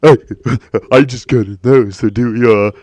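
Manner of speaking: loud, stammering leering middle-aged guy; creepy older man. Retold or imitated. Mocking grown men asking a young woman, loud stammering creep. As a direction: play a nervous creep who can't wait to ask. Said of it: "guys, full-grown men ... that are like".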